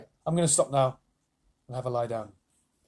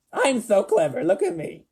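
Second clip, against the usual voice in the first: high, louder boastful squeak; smug fly voice; 7 semitones higher, 5 dB louder than usual. Acted. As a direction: brag like a silly fly.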